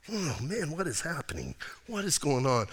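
groggy disciples. Retold or imitated. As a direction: play the sleepy disciples, barely awake and lost. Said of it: "they're all freaking out and they're all groggy".

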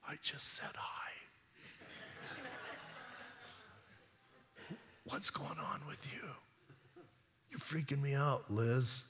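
hushed, hesitant whisper with long pauses; timid whisper. Acted. Preacher plays Mary freaked out by Elizabeth's outburst, timid whisper. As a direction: whisper it as if taken aback, very softly.

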